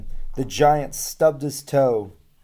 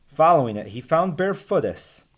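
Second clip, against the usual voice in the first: usual speaking voice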